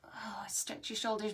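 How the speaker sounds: soft whispered instruction. Shown as a demonstration. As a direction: whisper it to the kids.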